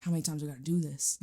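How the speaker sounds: breathless exasperation, then fast; exasperated groan. Retold or imitated. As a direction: sigh it out, fed up. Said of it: "some things is like".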